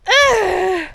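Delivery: loud exasperated ugh; frustrated groan. Real, not acted. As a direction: groan it out, fed up.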